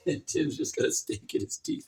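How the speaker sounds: ordinary talk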